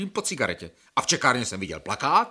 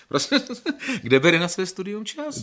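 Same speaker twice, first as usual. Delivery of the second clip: laughing question; 8 semitones higher than usual. Acted. Patient marvels at doctor's wife.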